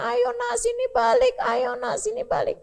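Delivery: high, pleading call to a son far away; father calling out. Acted. Noah calls his drowning son to come back, high and pleading. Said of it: "Nabi Nuh liatin".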